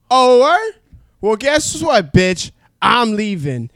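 loud, high, offended storm-out; a proud drunk man telling her off, loud; indignant yell. Retold or imitated. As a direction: throw it back at her and leave. Storming out after ultimatum.

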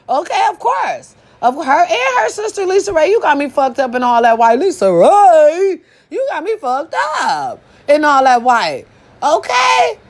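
high, excited gushing; hyped fangirling. Acted. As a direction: squeal over her like a fan. Hyped shout-out to Lisa Ray.

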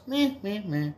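high nasal-free bleating meh; koala bleat. Acted. Koala answers the prostitute with a high bleat. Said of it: "the koala bear goes".

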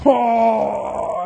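high loud yelp of being blinded; pained cry. Retold or imitated. Fighter blinded by a handful of dust, high yelp. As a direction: cry out as the dust hits your eyes. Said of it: "and he's like".

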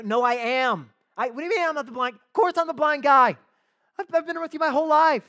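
high, loud mock protest; hammed-up exasperated shout. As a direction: act out an exasperated man insisting on who he is. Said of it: "you're getting a bit exasperated".

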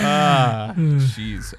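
overloaded mic crackle; too loud, mic clipping; clipping distorted mic. Real, not acted. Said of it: "I just clipped the mic on that laugh".

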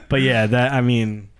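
ordinary talk, nothing marked

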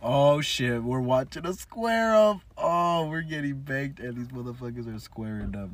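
loud, high play-by-play shout; excited fight commentary. Pretend. Calling a fight about to start, loud excited commentary. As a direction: hype up the brawl like a ringside caller.

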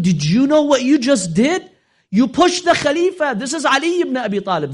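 high, loud alarmed scolding; alarmed crowd shout. Acted. Crowd scolding the seller who pushed the Khalifa. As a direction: tell him off, shocked at what he did.

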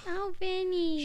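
high mock New York whine; put-on high New York voice; New York accent impression. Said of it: "with that accent".

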